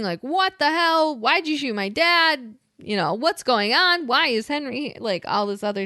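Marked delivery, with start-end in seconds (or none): 0.2-4.9 high-pitched retold outcry, not actually shouted; mock screaming. Retelling everybody's reaction to the shooting, mild mock scream. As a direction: imitate frantic questions without raising the volume. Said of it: "like, screaming".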